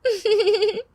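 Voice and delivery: high, soft giggle; flirty giggle. Acted. Tina giggles at Ravi's flirting, coy. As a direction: giggle coyly.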